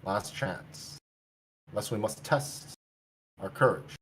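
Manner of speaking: soft, low, threatening; quiet warning with menace; low menacing. Acted. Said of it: "and he says".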